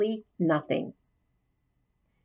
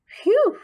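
high, loud squeaky phew of relief; small hamster's relieved high phew; 6 dB louder than usual. Acted. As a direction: blow out a tiny squeaky sigh of relief. Hamster relieved not replaced.

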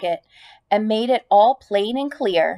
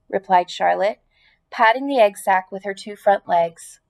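ordinary delivery, nothing marked

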